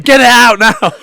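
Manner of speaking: high loud playful command; hammed-up insistent shout; mock demand. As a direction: bark it as a teasing order.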